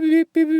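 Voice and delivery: high, quick electronic beeps mimicked with the voice; imitating watch beeps. Describing pool laps to listeners, mimicking high watch beeps. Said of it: "it goes beep".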